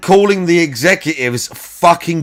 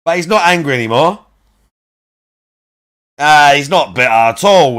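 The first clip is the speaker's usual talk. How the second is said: sarcastic; 4 dB louder, faster than usual. Acted. Sarcastic mockery of streamer.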